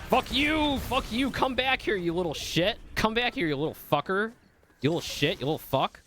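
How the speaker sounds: high, heated cursing at an escaping enemy; heated yelling. Real, not acted. Gamer cursing a fleeing opponent, heated. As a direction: yell after them, pitch way up. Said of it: "Sorry, I'm getting heated".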